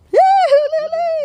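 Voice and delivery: high, loud squeal of joy; excited cheer. Real, not acted. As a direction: shriek with delight at the catch. Cheering a fish catch.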